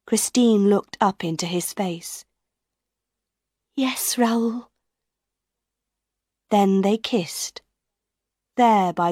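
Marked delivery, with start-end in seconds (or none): 3.8-4.7 tender whisper. A narrator's reading. Christine whispering yes to Raoul's proposal. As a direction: breathe your consent.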